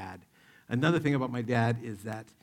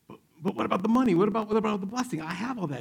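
higher, louder and fast, stammering alarm; a son begging to keep his winnings; panicked protest; 9 semitones higher, 5 dB louder, much faster than usual. Acted. Jacob panics about losing money.

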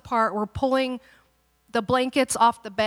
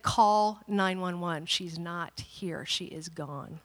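slow, firm insistence; 4 semitones lower, slower than usual. Retold or imitated. Husband repeating himself to wife in denial, slow and firm. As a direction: spell it out gravely, word by word.

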